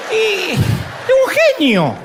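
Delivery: lento, más agudo y entre risas. Real, not acted. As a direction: remata riéndose, lento. Remate cómico riendo. ("Y es un genio" lento, riendo)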